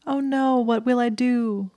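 high, drawn-out fake dismay; hammed-up damsel in distress, pitched well up; mock horror. A narrator's reading. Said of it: "she said in mock horror".

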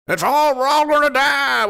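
high, hammed-up doomsday wail; mock panic. Mocking climate doomsayers' failed predictions, mock panic. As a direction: wail it like a pretend alarmist.

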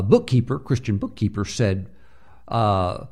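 usual speaking voice

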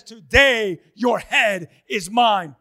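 loud, slow, deliberate threat; acted battle challenge, slow and forceful; defiant declaration. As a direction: proclaim it word by word at full force. David answers the mocking giant.

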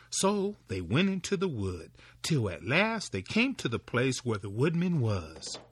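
usual speaking voice

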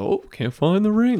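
high, hammed-up fake panic over the ring; the best man faking a lost ring, in mock alarm; mock best man joke. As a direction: play the joking best man, lightly. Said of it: "the best man typically is like".

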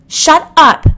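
high, loud shriek; near scream. A narrator's reading. Jill nearly screaming at Hillary, high and loud. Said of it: "Jill nearly screamed".

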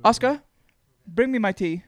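lady of the manor giving orders; imperious plummy grande dame. Pretend. Posh lady ordering servant.

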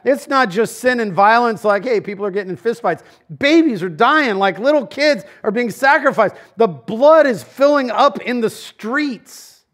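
high, loud sermon build-up; fiery preaching. Acted. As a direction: hammer it home to the congregation. Preaching at full force.

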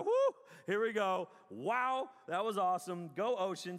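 loud whoop into cheering; amped-up worship. Retold or imitated. Worshiper amping it up for the band, loud and excited. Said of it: "amping it up a little bit".

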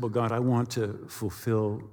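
low, soft bargaining; subdued plea. Retold or imitated. Pilot bargains with God about flying, soft low plea.

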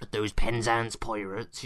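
put-on slow West Country accent; a hint of West Country pirate. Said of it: "West Country accent coming through".

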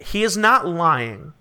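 "he is not lying" said slowly and pointedly, left hanging with a rise; pointed inflection. Acted. Game master hinting at half truths, "not lying" pointedly inflected. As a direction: draw out "not lying" as a hint. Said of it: "that inflection should tell you enough".

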